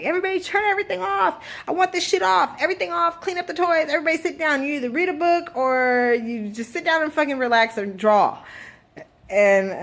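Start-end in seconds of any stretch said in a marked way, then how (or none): 0.0-8.4 put-on higher, fast commanding call to the kids; mom shouting orders. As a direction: bark house rules at the kids. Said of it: "when we're like".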